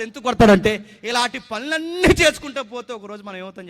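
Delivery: loud, high, impassioned sermon delivery; fervent preaching. Acted. Pastor preaching to the congregation, loud and fervent.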